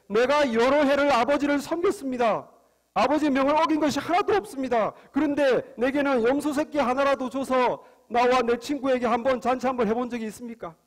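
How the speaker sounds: put-on angry older son. Older son to father after years of service, angry. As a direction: act out a son protesting in anger. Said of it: "화가 잔뜩 나서".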